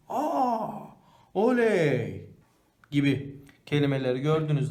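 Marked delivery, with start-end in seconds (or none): 0.1-0.7 high surprised gasp of aa. Shown as a demonstration. Teacher showing surprise, high aa. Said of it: "Nasıl mesela?". 1.3-2.3 cheering oley; joyful cheer. Shown as a demonstration. Teacher showing joy, cheering oley. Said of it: "Nasıl mesela?".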